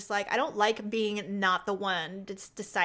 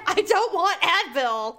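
high, loud, drawn-out protest; hammed-up whining refusal; mock petulant refusal; 6 semitones higher, 5 dB louder, slower than usual. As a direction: refuse it slowly and loudly, half laughing. Listener acts out the patient's refusal.

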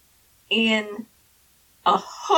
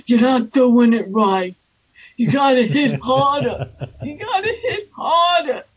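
put-on loud, hectoring old Italian man; mock yell, pushed louder; retold yelling; 4 dB louder than usual. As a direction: yell it like an angry old coach, half playful. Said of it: "He kept yelling at me".

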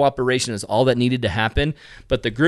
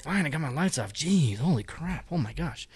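mumbled grumbling; 7 dB quieter than usual. Retold or imitated. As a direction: grumble it half to yourself. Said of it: "mumbling and murmuring to themselves".

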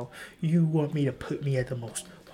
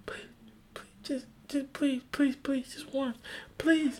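whiny begging; 11 semitones higher, 4 dB quieter than usual. Retold or imitated. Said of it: "I was like".

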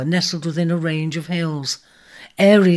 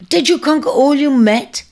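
high, louder, pressing; pressing question; 9 semitones higher, 6 dB louder than usual. A narrator's reading. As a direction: push for an answer. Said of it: "pressed a second".

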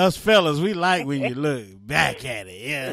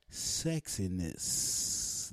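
slow sultry drawl; 6 semitones lower, 9 dB quieter, much slower than usual. Acted. Drawn-out end of ad read.

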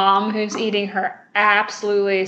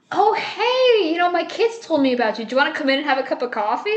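high, fast, breezy hostess welcome; sing-song mock hostess, fast and high; cheery hostess mom; 6 semitones higher, faster than usual. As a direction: play the chirpy hostess offering coffee. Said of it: "the mom's reaction is like".

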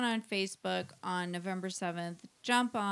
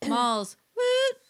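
excited cheer; 9 dB louder than usual. Real, not acted. Cheering for a friend.

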